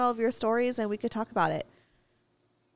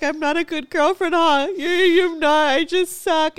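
high, loud mock sob; a hammed-up tearful wail; retold crying; 6 semitones higher, 6 dB louder, slower than usual. Girlfriend retelling her drunk self, mock crying. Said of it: "I just started crying 'cause I was like".

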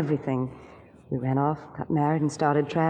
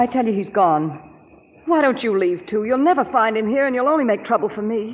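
louder, pleading and angry; heated denial; 5 semitones higher, 5 dB louder than usual. Acted. Cornered wife angrily insisting the detective leave. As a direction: push back hard, raising your voice.

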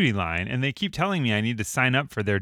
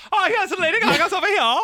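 high, laughing, hammed-up Germanish voice, much higher; playful clerk voice pitched way up; mock German clerk. Store clerk answers shopper in a silly mock German voice. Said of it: "Why are they talking like that?".

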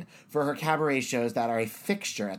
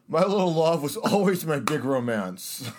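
lightly put-on Travolta-like straight guy; mock straight man; 3 semitones higher, 3 dB louder than usual. Shown as a demonstration. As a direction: play at a straight accent. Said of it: "It's like very John Travolta".

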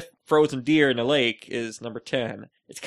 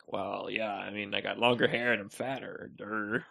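low, soft, dull mumble; retold dopey reply; 5 semitones lower, 8 dB quieter than usual. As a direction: answer as if stating the dumb obvious. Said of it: "I'm like".

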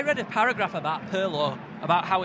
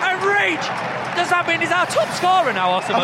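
high, very loud celebration; elated shout; 11 semitones higher, 10 dB louder than usual. Real, not acted. Commentator celebrates the scorer, elated shout. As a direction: shout it over the crowd.

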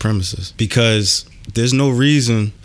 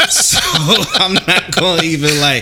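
laughing; 9 semitones higher, 6 dB louder than usual. Real, not acted. Laughs at own joke.

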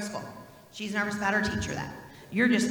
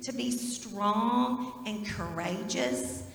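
slow, solemn reading of Joshua; scripture reading; 2 semitones higher, much slower than usual. Acted. Pastor reading scripture to children, slow and solemn. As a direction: lift the verse out slowly for the kids. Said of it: "He says".

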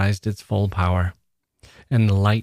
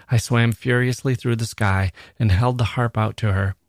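ordinary delivery

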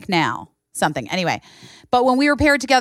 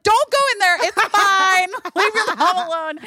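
high laughing aunt; 11 semitones higher than usual. Retold or imitated. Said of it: "I was like".

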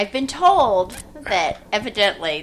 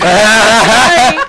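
big shouted cheer of yeah; loud yeah; 13 dB louder than usual. Real, not acted. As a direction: belt out the yeah in triumph. Group cheer after close try.

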